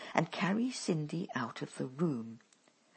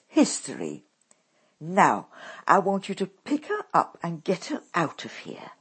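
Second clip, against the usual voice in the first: harsh commanding mother; 4 semitones higher, 9 dB louder than usual. A narrator's reading.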